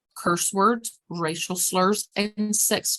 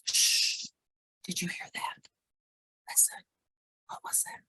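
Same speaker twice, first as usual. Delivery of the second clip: soft, secretive whisper; shush, then whisper as if listening for a sound; hushed whisper; 14 semitones higher, 8 dB quieter than usual. Shown as a demonstration. Caregiver trick to settle someone yelling, whispered. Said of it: "We can also use".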